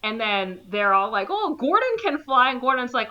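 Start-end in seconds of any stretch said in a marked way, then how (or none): 1.3-2.5 higher, louder mock-cheerful volunteering; chirpy friends. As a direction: toss Gordon under the bus brightly.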